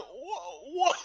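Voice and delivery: stammered, dumbstruck what; mock stunned. Retelling a man's reaction to bad food, mock stunned. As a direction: act lost for words. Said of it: "stunned beyond belief".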